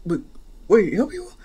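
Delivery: plain reading voice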